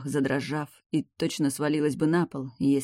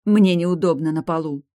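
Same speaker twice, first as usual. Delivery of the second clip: blurting out; 3 semitones higher, 7 dB louder than usual. A narrator's reading. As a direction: blurt it out in a rush. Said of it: "выпалила она".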